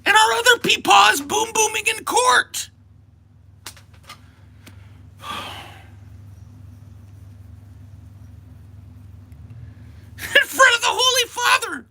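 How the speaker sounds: shrieking high, near-crying rant; mock panic. Streamer working himself into mock panic over election, high crying wail. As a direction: wail it in hammed-up hysteria. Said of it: "I'm getting worked up".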